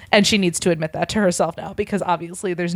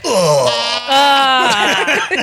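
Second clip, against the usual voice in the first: a hammed-up groan, higher and louder; mock groan; 5 semitones higher, 5 dB louder than usual. Narrator voicing Tom's relieved groan. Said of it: "made a sound deep in his throat".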